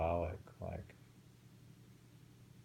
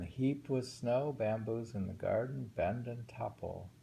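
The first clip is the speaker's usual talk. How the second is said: poem reading; 3 semitones higher, 3 dB louder than usual. Acted. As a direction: linger on each image. Rereads the haiku.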